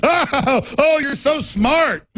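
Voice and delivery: high, slow, laughing mock admiration; sarcastic praise. Host mocking elites, high laughing sarcastic praise. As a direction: gush at them with a sneering laugh.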